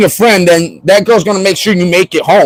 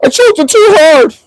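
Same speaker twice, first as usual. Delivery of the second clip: much higher, slow, hammed-up sob-talk of regret; mock crying; 9 semitones higher, slower than usual. Man joking he'd cry in the shower after choking, hammed-up wail. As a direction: wail it like a guilty crybaby in the shower. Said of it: "in the shower crying".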